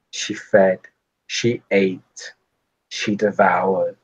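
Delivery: slow, brooding vampire voice with long pauses; creepy vampire voice. Acted. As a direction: let it drip with old menace. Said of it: "looks to you via the mirror and goes".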